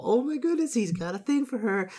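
very high excited squeal; high-pitched, delighted reaction; gleeful gossip squeal. Real, not acted. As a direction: squeal over a discovered crush. Reader reacts to a secret crush.

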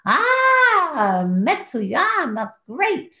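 mock delight. Mock delighted reply to nurse.

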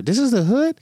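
high disbelieving question; mock astonishment; surprised. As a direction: rise up in disbelief. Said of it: "I was like".

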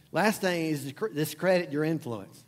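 usual speaking voice

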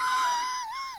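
high breathless wheezing laugh; squealing cry-laugh. Real, not acted. Helpless laugh after punchline.

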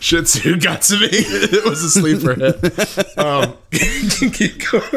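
giggling through the words; can't stop laughing at the joke. Real, not acted. Cohost cracking up at joke.

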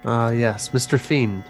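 ordinary delivery

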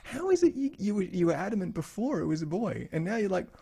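high-pitched puzzled challenge; retold incredulity. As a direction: press the doctor on the contradiction. Retelling parents questioning the doctor.

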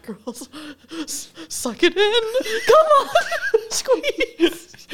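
high, loud, laughing encouragement; playful straining pep talk; mock coaching. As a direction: cheer them through it, breaking into laughter. Mock coaching women not to die.

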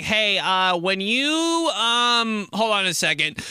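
high, halting phone voice; hesitant caller. Acted. Caller stalling on a bad line, hesitant. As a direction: stall with ums as a distracted caller.

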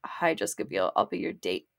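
low, soft suave imitation. Man introducing himself as her date, mock suave. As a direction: drop it deep and quiet, mock smooth. Acting out [name]'s suave entrance.

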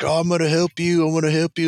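high-pitched friendly Irish voice; friendly Irish accent. Retold or imitated. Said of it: "friendly Irish sort of accent".

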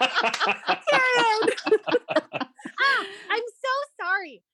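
laughing out the words. Real, not acted. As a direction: burst out laughing while talking. Laughing at her own mistake.

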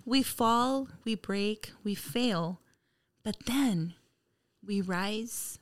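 dramatic quote, fading to soft; inspirational reading. Acted. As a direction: read it like a moving speech. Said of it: "something that inspirational for the crowd".

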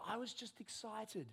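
lightly voiced toddler pleading innocence; little boy's excuse. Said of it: "He goes".

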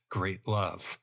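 usual speaking voice

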